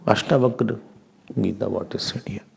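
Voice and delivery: breathy whisper, unvoiced; hushed aside to the class. Real, not acted. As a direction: drop to a whisper for the question.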